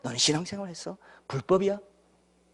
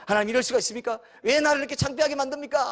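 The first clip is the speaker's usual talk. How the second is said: raised, wailing complaint to God; self-pitying prayer; 8 semitones higher than usual. Acted. Humiliated believer complains to God, mock wailing and raised. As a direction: whine it up to a cry.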